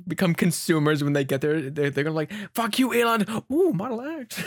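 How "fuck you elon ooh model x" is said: mock excited. Deviants mocking, then buying cars.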